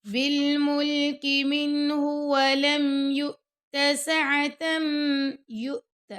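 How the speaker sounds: slow, measured tajweed chant; Quran recitation. Shown as a demonstration. Teacher reciting the verse to students, slow and melodic. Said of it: "جھٹکا دے کے پڑھنا ہے".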